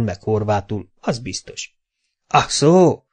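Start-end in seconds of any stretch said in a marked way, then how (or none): 2.3-3.0 loud, high cry of realisation; raised, louder "oh, I see"; exclaiming. A narrator's reading. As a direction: exclaim as it suddenly dawns on you. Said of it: "kiáltott Koprai bácsi".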